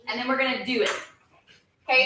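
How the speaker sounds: ordinary talk, nothing marked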